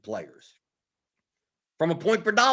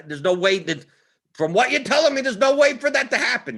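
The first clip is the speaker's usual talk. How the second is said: higher and louder, pressing the point; emphatic insistence; 9 semitones higher, 6 dB louder than usual. Real, not acted. Coach telling viewers the math won't allow it, emphatic louder push. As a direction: drive home that it's impossible.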